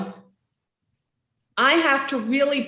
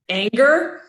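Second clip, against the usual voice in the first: ordinary talk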